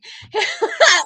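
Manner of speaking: high burst of laughter. Real, not acted. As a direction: laugh out loud at herself. Laugh at her own cluelessness.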